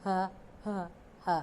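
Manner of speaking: ہ breathed out from the throat, repeated; breathy ہ sound. Shown as a demonstration. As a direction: demonstrate ہ with a clear breath. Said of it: "ہ کی sound". (ہ کی آواز، سانس کے ساتھ حلق سے)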